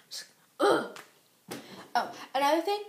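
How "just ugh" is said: a short fed-up groan of disgust; exasperated ugh. Real, not acted. Disgusted groan after retelling a slight.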